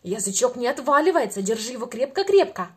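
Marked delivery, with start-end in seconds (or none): none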